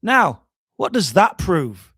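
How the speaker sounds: slow, lightly Scottish dry question; a hint of Scottish deadpan; slow mild Scottish-accented challenge. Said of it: "Lang just said".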